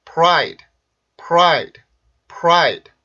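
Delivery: punched, falling "pride" with rounded pr; one-syllable English "pride", no added vowels; English pronunciation model. Shown as a demonstration. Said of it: "자, 발음 한번 해볼까요?".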